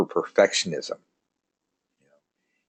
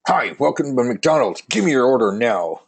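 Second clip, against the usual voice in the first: pushy cashier; 5 semitones higher, 5 dB louder than usual. Acted. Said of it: "a little rambunctious".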